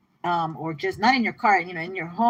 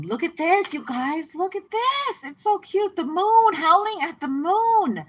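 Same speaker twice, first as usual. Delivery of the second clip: high, louder and bright with delight; showing the viewers a cute card, gushing excitedly; 10 semitones higher, 4 dB louder than usual. Real, not acted. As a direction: coo over something adorable. Gushing over the Moon card.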